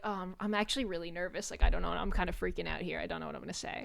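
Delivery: low, quick, hushed nervous rambling; imitating a shy, nervous fan under the breath; nervous confiding. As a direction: confide nerves softly, tumbling through the words. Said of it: "they confided in Altrive".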